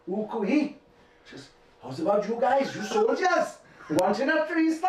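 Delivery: high, lilting Welsh woman's come-on; Welsh accent. Retold or imitated. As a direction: put on a high-pitched Welsh accent, calling out cheekily. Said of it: "I'll say it in accent".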